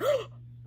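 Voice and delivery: high, loud, sharp gasp; mock shocked gasp. Reader re-enacting shock at the book, big gasp. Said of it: "literally gasping".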